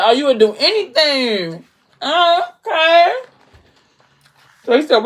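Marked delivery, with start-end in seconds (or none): 0.0-3.1 higher, drawn-out suggestive surprise; teasing interest. Retold or imitated. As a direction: seize on the offer, teasingly. He teases her offer, retold.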